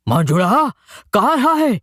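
a worried father shouting to his daughter; shouting from afar. Acted. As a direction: yell her name and ask what's wrong. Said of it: "सुखोबा ओरडला".

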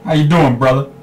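a hint of a buddy's hello, brother. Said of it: "That was my [name] impression".

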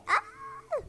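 sudden shriek, very high; startled scream. Real, not acted. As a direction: shriek as you nearly fall. Almost falls in the game. (grito agudo de susto)

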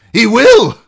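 high, loud cry betraying fear; failed sternness. A narrator's reading. Captive threatening his captors, sternness betrayed by panic. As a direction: try to sound stern and fail. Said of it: "his voice betrayed him".

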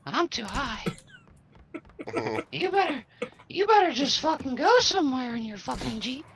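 ordinary talk, nothing marked

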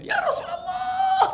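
high, slow, drawn-out shout of longing; anguished cry. Acted. Thauban calling out to the returning Prophet, high anguished shout. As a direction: cry out his name as you run to him.

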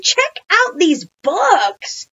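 high, loud, drawn-out call; shouting. A narrator's reading. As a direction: shout about an exciting find. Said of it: "Ryan shouted".

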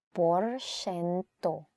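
Porsyento stretched very slowly, every syllable held; slow careful model word; very slow teaching repeat. Shown as a demonstration. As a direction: draw Porsyento out for a learner.

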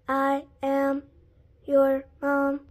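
low, monotone robot voice; each word separate, on one level note. Acted. Told to walk and talk like a robot, robot voice. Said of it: "talk like a robot".

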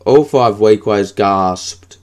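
usual speaking voice

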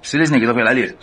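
ordinary delivery

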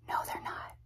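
hushed conspiratorial whisper; whispered aside. Acted. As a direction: whisper it as a secret to the camera. Whispered aside contradicting herself.

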